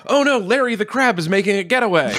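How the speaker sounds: a hint of a panicked sports caller; mock alarmed announcer. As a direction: play a lightly hammed commentator spotting an escape. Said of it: "if you were like".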